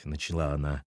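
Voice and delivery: plain reading voice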